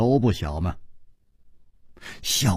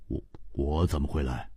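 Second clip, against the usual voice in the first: mild puzzled surprise; 9 semitones lower, 10 dB quieter than usual. A narrator's reading. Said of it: "更惊讶说".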